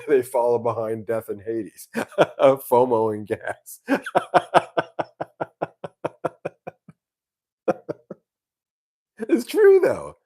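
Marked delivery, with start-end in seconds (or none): 3.9-6.9 long, high cackle of laughter; real laughing. Real, not acted. Guitar vlogger amused by his fomo joke, long laughing fit. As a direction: crack up helplessly at your own joke. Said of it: "I'm laughing".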